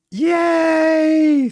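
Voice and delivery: long, loud, very high squeal; delighted cheer. Real, not acted. Streamer finds the lost glass, delighted high-pitched cheer. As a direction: let out a shrieked cheer of relief.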